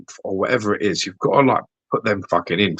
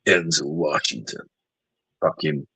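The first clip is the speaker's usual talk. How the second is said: movie trailer voice; 4 semitones lower, 5 dB quieter than usual. Retold or imitated. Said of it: "So it'll be like this".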